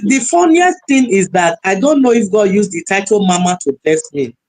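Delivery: usual speaking voice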